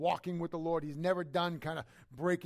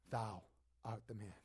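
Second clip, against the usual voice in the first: hushed accusation; 6 semitones lower, 10 dB quieter than usual. Acted. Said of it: "Nathan stops him and says".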